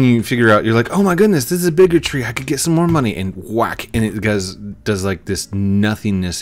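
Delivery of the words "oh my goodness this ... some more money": excited. Pretend. New player spots bigger tree.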